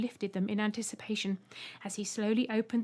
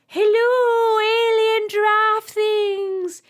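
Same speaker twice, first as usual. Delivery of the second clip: very high, loud and slow; piercing greeting; 12 semitones higher, 13 dB louder, much slower than usual. A narrator's reading. Robot greeting the aliens, very high and loud. Mip mimics the Tremblers' greeting.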